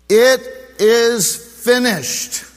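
slow, loud, high proclamation; final declaration. Acted. Preacher voicing Jesus' last words on the cross, slow loud declaration. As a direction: declare it with triumphant weight. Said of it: "his final declaration".

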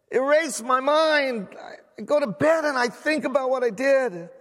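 impassioned pleading prayer; crying out to God. Acted. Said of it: "he cried out to God".

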